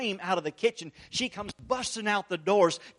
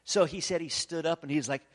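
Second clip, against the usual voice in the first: ordinary delivery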